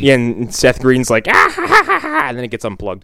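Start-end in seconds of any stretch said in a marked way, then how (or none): 1.3-2.3 loud, high "ah-ha-ha" outburst; mock high-pitched staccato wail; imitated high cackling cry. Imitates Seth Green's cry.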